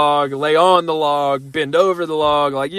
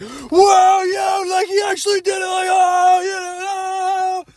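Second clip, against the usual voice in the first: friends cheering; much slower than usual. Retold or imitated. Basement friends cheering when Ben walks back in. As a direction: shout it up high like a hyped crowd. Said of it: "They were like".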